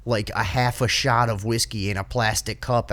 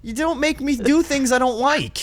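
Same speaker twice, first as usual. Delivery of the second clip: high-pitched mock complaint; acted protest; 11 semitones higher than usual. Telling pushy festival-goers to back off, protesting. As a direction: push back in a raised, pleading pitch.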